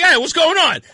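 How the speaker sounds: high, loud mock husband; husband's loud reply. Retold husband answering his shouting wife, calling out. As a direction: call it out as the summoned husband.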